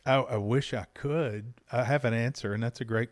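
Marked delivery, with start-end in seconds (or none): none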